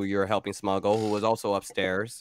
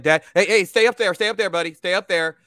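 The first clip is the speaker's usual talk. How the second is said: high, raised, repeated warning; urgent dad; 7 semitones higher, 5 dB louder, faster than usual. Acted. Dad telling his little boy to stay upstairs, urgent and high. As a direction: call out quickly to stop a child. Dad stops child coming downstairs.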